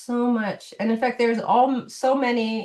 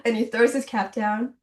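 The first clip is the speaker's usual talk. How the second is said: ordinary talk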